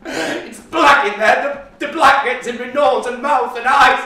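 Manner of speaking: high, loud frantic protest; panicked shouting. Acted. Boy yelling at his mother as she drags him upstairs. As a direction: fight back, yelling in panic.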